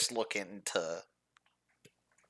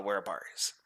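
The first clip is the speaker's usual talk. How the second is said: whispered aside; 6 dB quieter than usual. Acted. Bennett whispers.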